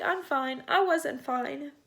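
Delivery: admitting through tears; tearful. Real, not acted. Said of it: "I did not mean to cry".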